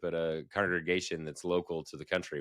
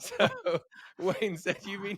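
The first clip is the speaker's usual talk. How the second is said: laughing; 10 semitones higher than usual. Real, not acted. Laughing through punchline.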